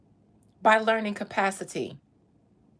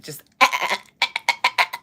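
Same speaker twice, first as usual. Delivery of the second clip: choked, strained ah cries; strangled goat; 8 dB louder than usual. Retold or imitated. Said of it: "Like a strangled goat".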